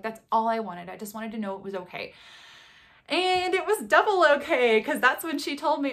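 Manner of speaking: usual speaking voice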